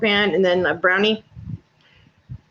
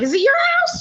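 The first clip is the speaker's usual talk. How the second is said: much higher, mocking comeback; sassy retort. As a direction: toss it back high and cheeky.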